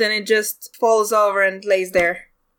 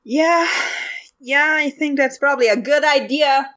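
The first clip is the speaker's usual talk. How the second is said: yelling after; 5 semitones higher than usual. Acted. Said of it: "she yells after".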